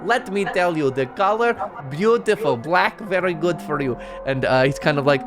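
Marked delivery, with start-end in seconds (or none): 0.0-4.0 higher, fawning compliment voice; higher, gushing compliments on her hair; Blinsky the toymaker. Acted. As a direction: flatter them like an eccentric shopkeeper. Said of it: "He says".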